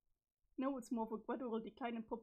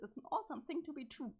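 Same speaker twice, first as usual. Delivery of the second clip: ordinary talk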